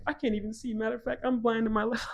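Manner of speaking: high mock-innocent protest; mock pleading. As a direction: plead your way out, half-joking. Continues mock panicked denial, joking excuse.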